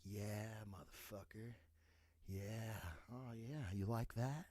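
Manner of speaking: hushed, low, gloating savor. Retold or imitated. A man gloating quietly over his crossed-off goal. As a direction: softly gloat over the win. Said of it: "You're like".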